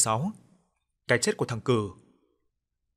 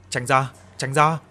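slow, louder repeated command; yelling; 6 dB louder, slower than usual. A narrator's reading. Friend pushing through the crowd, yelling. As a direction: shout to clear a path. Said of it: "Anh Thành gào lên".

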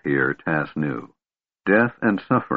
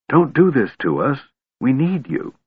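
higher, imploring appeal; urgent pleading; 6 semitones higher than usual. A narrator's reading. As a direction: beg a friend not to give up. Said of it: "Tika said, shaking him".

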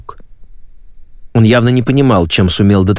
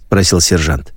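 ordinary delivery

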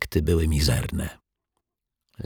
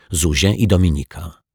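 usual speaking voice

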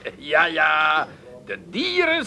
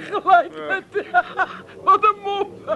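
laughing through the words; 7 semitones higher than usual. Acted. Friend laughing off the threat of being thrown overboard. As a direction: chuckle it out mockingly.

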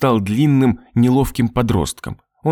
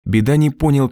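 ordinary delivery, nothing marked

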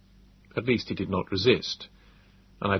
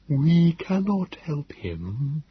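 gentle; 6 semitones higher, much slower than usual. Acted. Nebogipfel telling the narrator they must leave Philby, gentle. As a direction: let him down gently. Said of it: "he said gently".

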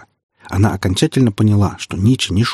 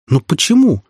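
upset protest; 8 semitones higher, 4 dB louder than usual. Acted. Sonya protests loudly.

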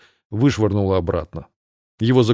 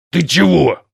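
growling; 6 semitones higher, 4 dB louder, slower than usual. A narrator's reading. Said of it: "прорычал он".

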